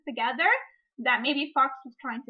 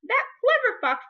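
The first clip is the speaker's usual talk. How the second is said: much higher, louder bird cry; ravens crying out; 10 semitones higher, 6 dB louder than usual. Acted. Ravens praising the fox as they fly in, high bird cry. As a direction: crow it out like an admiring raven. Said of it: "the ravens cried".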